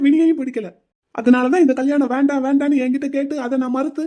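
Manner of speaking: angry accusation. Acted. Groom accusing his friend of poisoning his brother, angry. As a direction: pile the blame on him.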